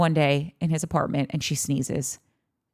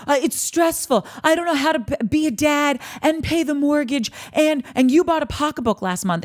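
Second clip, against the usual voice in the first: pitched-up whiny mock of Jax; whiny husband mockery; 7 semitones higher than usual. A wife mocking her husband's excuses, whiny. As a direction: whine like a put-upon husband. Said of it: "You walk in here and say".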